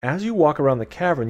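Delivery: ordinary talk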